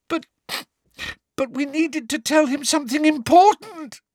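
high, loud put-on wail. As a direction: wail it like fake tears. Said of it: "wailed Hermione".

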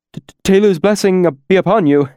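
nervous stammer, high and fast. Acted. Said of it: "stammered explosively".

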